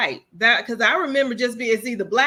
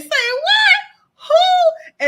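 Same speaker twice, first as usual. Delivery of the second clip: put-on high, loud shocked cry of an old aunt; aunt's shocked shriek; 8 dB louder, much slower than usual. Aunt's favourite outburst retold, shrieking high and loud. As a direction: shriek her catchphrase in disbelief. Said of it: "She was always saying".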